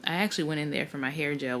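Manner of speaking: ordinary delivery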